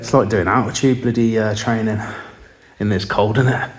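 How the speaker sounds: breathless, catching breath. Real, not acted. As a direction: still puffing, laughing a little. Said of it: "I'm out of breath".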